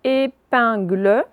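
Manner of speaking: "épingle" slow and loud, syllable by syllable; slow "épingle", final syllable rising; reading out words. Shown as a demonstration. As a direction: dictate "épingle" with separated syllables. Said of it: "je vous lis d'abord les mots".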